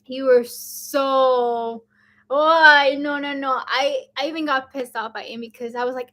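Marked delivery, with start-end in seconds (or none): none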